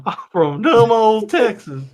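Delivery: slow, high hammed-up Texas twang; mock Texas accent. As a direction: play a dumb Texan, slow and loud. Mocks Texas, then plans an accent.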